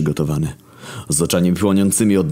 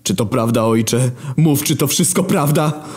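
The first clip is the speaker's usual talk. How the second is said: raised anguished demand; Jesus; 8 semitones higher than usual. Acted. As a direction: plead with father for the truth.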